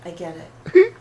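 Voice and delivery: sharp loud snap; snapping. Acted. Beth snaps at the group that she already knows, loud. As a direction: bark it back defensively.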